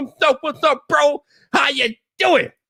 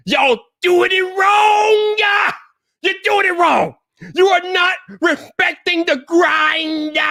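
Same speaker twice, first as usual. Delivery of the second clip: ranting; 7 semitones higher, slower than usual. Acted. Ranting at entitled creators.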